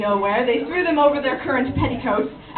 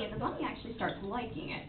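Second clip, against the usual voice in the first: ordinary talk, nothing marked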